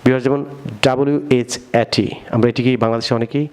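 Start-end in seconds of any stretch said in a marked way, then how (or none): none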